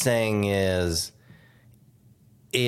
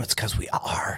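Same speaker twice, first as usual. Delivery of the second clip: whispering; 4 dB quieter than usual. Real, not acted. Jokey aside about empty room.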